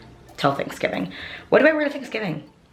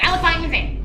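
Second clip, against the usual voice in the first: high squeal; 10 semitones higher, faster than usual. Shown as a demonstration. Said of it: "repeated in high squeal".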